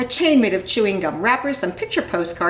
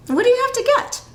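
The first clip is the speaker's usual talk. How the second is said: put-on curious child voice; 4 dB louder, faster than usual. Child voice asks.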